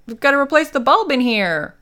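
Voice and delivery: high, loud call through the house; acted-out shout to someone out of sight; retold yell. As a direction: holler it up high, imitating her. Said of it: "She yells".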